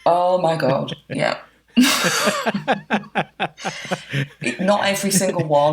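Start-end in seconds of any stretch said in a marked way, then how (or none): 1.8-4.6 real high burst of laughter; a long, helpless giggling laugh at oneself. Real, not acted. Asked if she cries at weddings.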